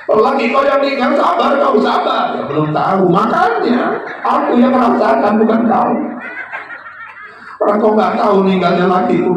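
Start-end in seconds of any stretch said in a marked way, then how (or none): 0.0-2.4 high, loud mocking shout; mock furious widow. Widow shouting at the neighbour who tells her to be patient. 3.1-9.3 very high, loud hammed-up yell; mock angry wail. Widow yelling at the neighbour, hammed up.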